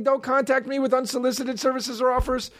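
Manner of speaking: high, prissy mock voice of the poster; mocking quote. Comedian mocking an ad poster, high and prissy. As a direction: whine it out like a fussy guy. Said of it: "that guy is saying".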